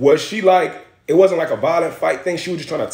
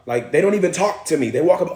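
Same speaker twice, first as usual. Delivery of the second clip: ordinary talk, nothing marked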